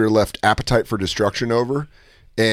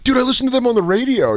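put-on excited kid; 9 semitones higher than usual. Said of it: "I was like".

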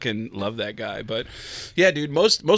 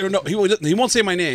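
normal talking voice

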